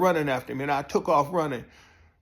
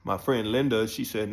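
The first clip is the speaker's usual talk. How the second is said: usual speaking voice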